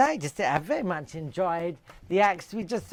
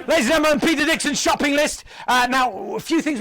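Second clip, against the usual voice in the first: Peter Dixon; 7 semitones higher, 6 dB louder than usual. Pretend. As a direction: bellow each item like a show host. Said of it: "I myself will play Peter Dixon".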